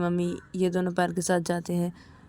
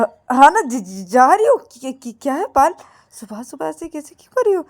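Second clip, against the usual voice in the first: stammering scared reply; 7 semitones higher, 10 dB louder than usual. Acted. Frightened Ananya stammers back at angry Payal. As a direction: stutter out of sudden fear. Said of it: "Ananya डर जाती है".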